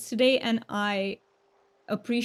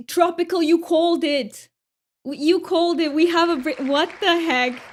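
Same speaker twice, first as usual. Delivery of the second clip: gasp, then higher and louder, thrilled; excited disbelief; 6 semitones higher, 4 dB louder than usual. Real, not acted. Streamer tells a viewer they called the spin, thrilled. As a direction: squeal that a viewer predicted it. Wheel lands on British accent.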